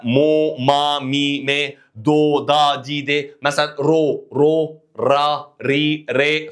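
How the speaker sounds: "مو، ما، مي" and the rest as separate, held syllables; syllable drill. Shown as a demonstration. Teacher recaps word endings, drilling "مو، ما، مي" onward slowly, syllable by syllable. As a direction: chant evenly through "مو، ما، مي" to "ري", one by one. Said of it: "تعرف تستخدم النهايات".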